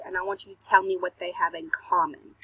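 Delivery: ordinary delivery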